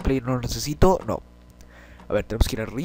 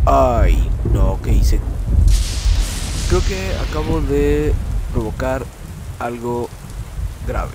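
real loud dismay; 4 dB louder, much slower than usual. Real, not acted. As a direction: panic over his mistake. Realizes he caused trouble.

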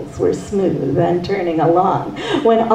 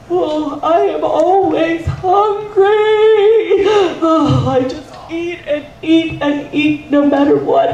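high, sing-song always hungry man; 8 semitones higher than usual. Acted. Always Hungry character speaks.

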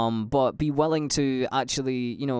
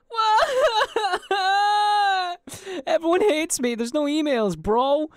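high, hammed-up fake crying; joking whine over no emails; mock despair; 4 dB louder than usual. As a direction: wail in pretend heartbreak. Mock despair at empty inbox.

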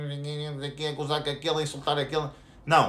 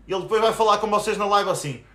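ordinary delivery

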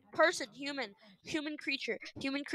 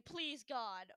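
usual speaking voice

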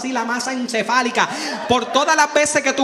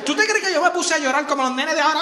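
ordinary talk, nothing marked

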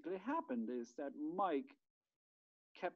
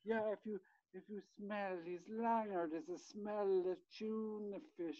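niece's voice; 6 semitones higher than usual. Retold or imitated. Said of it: "another voice goes".

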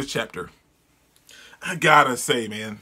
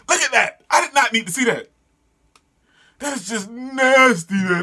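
high-pitched, appalled reaction; high, incredulous disgust; disgusted exclamation; 7 semitones higher than usual. Real, not acted. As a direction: recoil from it, voice pitched up. Disgusted reaction to manga page.

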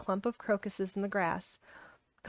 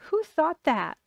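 loud, high-pitched indignant question; angry; 7 semitones higher, 6 dB louder, slower than usual. A narrator's reading. Boy called half-witted demands who thought so, angrily. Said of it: "said Colin angrily".

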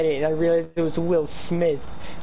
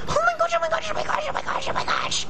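panicked squeal; faster than usual. Real, not acted. Zombies chasing her in game.

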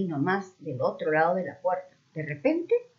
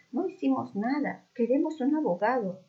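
mock pleading; 6 semitones higher than usual. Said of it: "volvió a gritar Camila".

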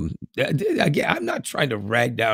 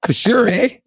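loud, high, hammed-up Canadian "For sure, eh?"; 13 semitones higher, 7 dB louder than usual. Belt out an over-the-top Canadian "For sure, eh?" much higher and louder. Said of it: "you're just being a hoser, eh?".

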